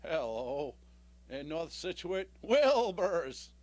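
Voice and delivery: low, slow-talking horse impression; Mister Ed. Comic as Mister Ed calling information, low horse voice. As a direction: talk like the deep-voiced TV horse. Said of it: "This is my impression of Mister Red".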